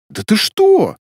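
high, indignant outburst; heated protest. A narrator's reading. Илья objecting to Светлана leaving, getting heated. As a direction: flare up in disbelief, pitch jumping. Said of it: "закипятился Илья".

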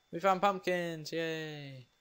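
excited cheer. Real, not acted. Player finds pumpkins in the game, happy excited cheer.

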